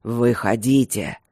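low, slow growled order; commanding growl. A narrator's reading. King ordering the men out of the cave, commanding growl. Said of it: "прорычал повелительное".

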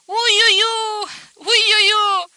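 loud, very high "uiuiu" whoop. A narrator's reading. Girl hoisted on his shoulder, whooping in fright or joy. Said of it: "fetișcana chiuise".

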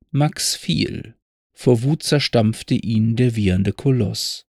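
slow, measured ballad reading; poem recitation. Acted. Narrator reciting Pfeffel's poem to listeners, slow and measured. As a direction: recite it like an old story poem.

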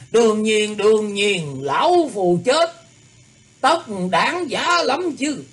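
high, slow, proud elder; proud old man voice. A narrator's reading. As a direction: savour each word with pride.

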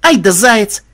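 high, loud cheer; admiring exclamation. A narrator's reading. Granddaughter admiring the fighting rabbit, excited cheer.

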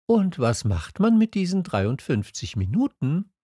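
high questioning voice; curious young questioner. A narrator's reading. Prince asks the merchant about saved minutes, high voice. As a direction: ask it high and light.

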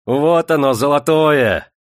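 high, loud exultation; gleeful cry. A narrator's reading. Prokofy revels beside the flogged body, loudly gleeful. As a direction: burst out crowing with delight.

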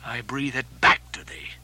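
put-on low, intense old captain's voice; brooding sea captain speaking to the fire; Ahab, defiant captain. Ahab addressing the corposants, defiant and brooding.

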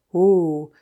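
loud, smug Oh; self-important. A narrator's reading. Amateur detective boasting to his sweetheart, self-important. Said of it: "fis-je avec suffisance".

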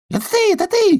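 very high, loud joyful squeal; excited shout. Acted. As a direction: squeal with delight like a little duck. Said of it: "кричал Чирок".

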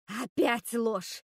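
медленный гневный вопль; протяни со злостью, как обвинение. A narrator's reading. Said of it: "завопила Готель". (медленно, с яростью: «Опять ложь!»)

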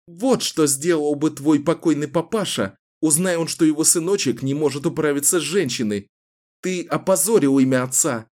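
high, scornful mockery; defiant woman taunting. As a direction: needle him, sneering. Taunting the captor. (Кэлен издевается над главарём)

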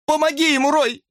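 very high-pitched, panicked cry. A narrator's reading. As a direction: scream for someone to help. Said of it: "закричала Брук". (отчаянный крик)

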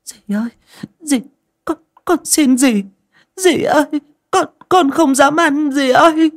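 put-on high, sobbing child's plea; tearful begging. A narrator's reading. Kneeling girl begs stepmother for mercy, crying. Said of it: "quỳ xuống chắp tay khấn sống mụ".

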